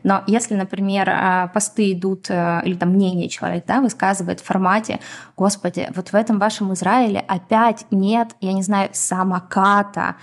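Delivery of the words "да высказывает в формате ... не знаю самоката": ordinary delivery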